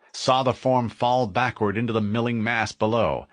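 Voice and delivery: ordinary delivery, nothing marked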